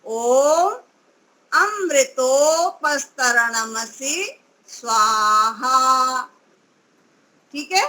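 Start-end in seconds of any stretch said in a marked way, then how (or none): 0.0-6.2 ओम, अमृतोपस्तरणमसि, स्वाहा each said separately with pauses, स्वाहा slow and low; words kept apart. Shown as a demonstration. Teacher showing three mantra words spoken apart. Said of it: "तीनों को अलग अलग बोलना है".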